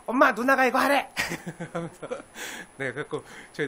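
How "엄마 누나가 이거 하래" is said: higher, smug tattletale voice; tattling little brother. Retold or imitated. The pastor as a boy passing on mom's orders to his sister, smugly. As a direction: rub it in that mom said so. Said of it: "제가 누나한테".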